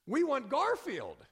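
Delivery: slow, high, loud crowd cheer; crowd's reply. Acted. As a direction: answer back loudly as a convention crowd. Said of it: "the crowd replied".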